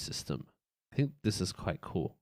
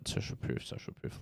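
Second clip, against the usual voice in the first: whispering the list to himself; soft voiceless whisper; 9 dB quieter than usual. Real, not acted.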